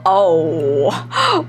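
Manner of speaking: usual speaking voice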